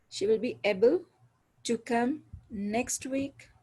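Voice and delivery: slow phrase-by-phrase model with "next week" set apart; divided into thought groups. Shown as a demonstration. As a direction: lift "able", pause, then slow "to come", pause, "next week". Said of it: "sentence को divide करें".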